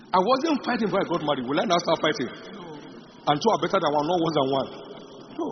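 ordinary talk

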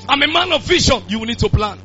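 ordinary delivery

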